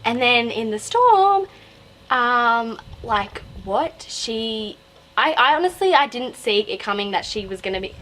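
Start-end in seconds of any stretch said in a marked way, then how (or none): none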